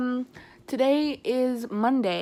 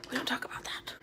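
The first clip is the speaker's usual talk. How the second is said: whispering; 3 semitones higher, 8 dB quieter, faster than usual. Real, not acted. Embarrassed aside.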